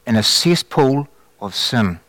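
slow and deliberate, leaning on cesspool; slow solemn quote. Retold or imitated. Preacher finishing the Lord's words to the congregation, slow and grave. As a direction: let the warning sink in slowly. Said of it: "now listen to this next part".

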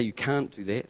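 the same words dropped low and soft; subdued mutter of protest; low, soft and flat. Shown as a demonstration. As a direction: say it low and quiet, no fight in it. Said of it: "He doesn't say".

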